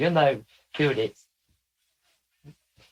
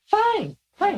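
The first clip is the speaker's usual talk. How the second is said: loud, very high squeak; Leica; 5 dB louder than usual. Acted. Channeled lieutenant answers how she is doing, in a loud squeak. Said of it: "somebody that has a very squeaky voice".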